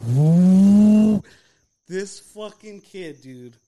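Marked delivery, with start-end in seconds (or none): 0.1-1.2 very loud imitated blower roar; hand dryer whoosh. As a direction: blast out a long rising dryer noise. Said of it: "the thing goes fucking".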